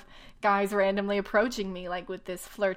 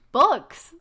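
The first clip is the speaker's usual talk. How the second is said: one loud, higher word, cut short; blurted answer; 5 semitones higher, 11 dB louder than usual. Acted. Mocking the heroine answering him, loud blurted reply. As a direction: punch the single word like a proud reveal.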